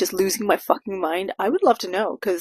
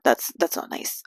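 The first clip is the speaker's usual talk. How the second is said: softer, whispered self-correction; whispered aside; 5 semitones lower, 6 dB quieter than usual. Real, not acted. Walking back her trash advice to viewers, sheepish whisper. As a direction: take it back under your breath. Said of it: "Okay, maybe don't do that".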